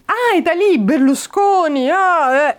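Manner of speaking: sneering mock delight; mocking exclamation. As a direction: taunt an Italian about Berlusconi. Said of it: "a commencé à se moquer de moi".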